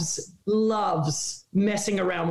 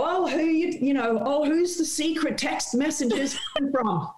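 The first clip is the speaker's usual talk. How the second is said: higher, playful interviewer probe; teasing interviewer question; 7 semitones higher than usual. Retold or imitated. Interviewer teasing the star on air, coy higher question. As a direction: ask it coyly with a grin. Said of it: "I look at her, I go".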